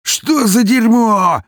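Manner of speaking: high, slow, loud howl. Acted. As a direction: howl it out in pain and rage. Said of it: "взвыл убийца".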